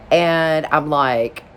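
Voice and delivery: ordinary delivery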